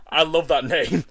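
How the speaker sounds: loud, laughing exclamation; laughing delight. Real, not acted. Streamer tickled by a silly nickname, laughing out loud. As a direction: say it loudly through laughter.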